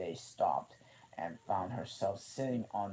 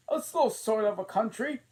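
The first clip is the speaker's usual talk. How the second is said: high, loud scornful queen; the Queen mocking Alice's country; 10 dB louder than usual. A narrator's reading. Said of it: "said the queen".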